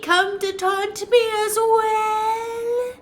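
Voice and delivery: high, slow, wailing ghost woman; wailing wraith. Acted. Wraith shrieking at the intruder, high wailing moan.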